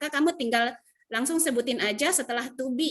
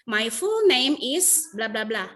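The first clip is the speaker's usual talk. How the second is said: slow model sentence; 4 dB louder, slower than usual. Shown as a demonstration. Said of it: "Jadi bisa dipilih salah satunya".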